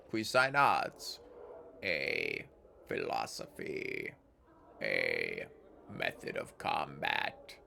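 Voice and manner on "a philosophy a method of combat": very low, very slow, ponderous statue; stone giant voice. Acted. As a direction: grind out each word like rock.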